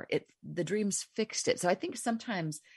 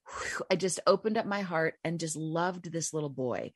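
ordinary delivery

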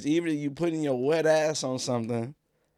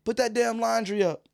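higher, mock scolding mom; yelling mom; 8 semitones higher than usual. Mom hollering everyday insults at her son. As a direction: rattle off the insults like an angry mom. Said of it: "all the other insults".

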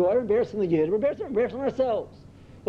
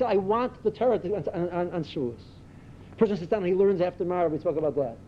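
ordinary talk

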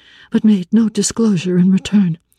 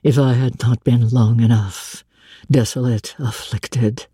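lightly lowered, brooding man's voice; a blind master confiding his anguish; a hint of a grave older man; Rochester's voice; 7 semitones lower than usual. Rochester recounting to Jane.

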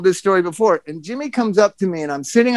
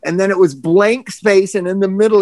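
normal talking voice

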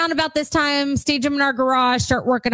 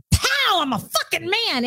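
higher-pitched mock manly burst; overbearing macho man; 6 semitones higher than usual. Mocking an overpowering masculine scent, in a hammed-up macho burst. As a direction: pop out "Pow" as a brash he-man. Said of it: "it's not like".

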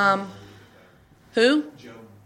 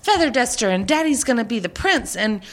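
put-on high, eager little-kid voice handing out roles; imitating eager kids; 9 semitones higher than usual. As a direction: mimic the kids bossily casting the play. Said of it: "You know, it's like".

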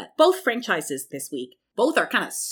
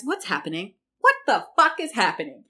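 usual speaking voice

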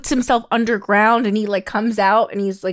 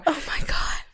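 shocked whisper; 3 semitones higher, 10 dB quieter than usual. Real, not acted. Shocked reaction.

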